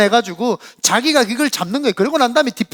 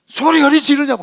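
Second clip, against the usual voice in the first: higher, louder scolding shout; shaman scolding; 6 semitones higher, 5 dB louder than usual. Retold or imitated. Shaman scolding the young man, loud and high. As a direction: snap back at him. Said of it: "막 싸우고 막 그러더라고요".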